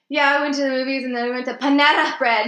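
put-on high, loud valley girl accent.

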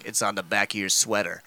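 low, quiet gruff hero voice; deadpan Batman. Retold or imitated. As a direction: growl it low and flat, unimpressed. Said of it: "And he's like".

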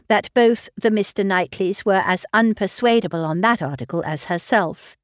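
ordinary delivery, nothing marked

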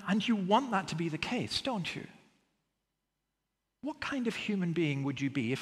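normal talking voice

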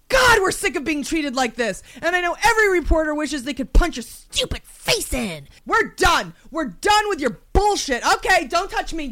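theatrical angry rant; raging out. Pretend. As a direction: declare you're finished, indignantly. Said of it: "raging out in my mind".

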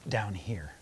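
hushed, breathy whisper. Acted. GM whispering the hidden voice down the passage. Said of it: "can hear what sounds like shuffling feet".

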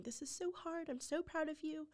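high, crying-voiced apology; retold sobbing plea. Mother on the phone to birth mom, tearful high plea. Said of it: "I was sobbing, going".

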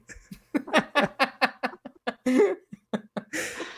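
helpless, high-pitched laughter; long high laughing fit. Real, not acted. Teasing co-host over kid routine, laughing hard. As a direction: crack up completely.

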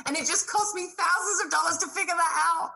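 ordinary delivery

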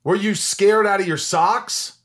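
high, loud and slow, amazed; excited. Real, not acted. Host amazed she landed the role, excited and slow. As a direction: stretch it out in delight. Host thrilled by the audition story.

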